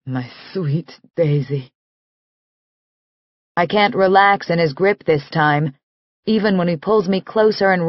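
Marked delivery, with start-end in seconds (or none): 0.1-1.7 tender. Acted. Nick tenderly holds Daisy.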